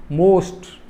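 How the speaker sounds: "most" in a single effort, no twist of the tongue; one-syllable demo. Shown as a demonstration. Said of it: "only with a single effort".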